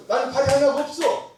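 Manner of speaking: high, emphatic quoted declaration; acted defiant testimony. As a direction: build to an exuberant challenge. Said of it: "그래서 이분이 그랬대".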